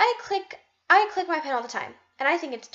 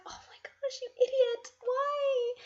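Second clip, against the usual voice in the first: self-scolding whisper; 10 semitones higher, 6 dB quieter, slower than usual. Retold or imitated. Scolding herself for interrupting friends, high whisper. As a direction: whisper high and sharp at yourself.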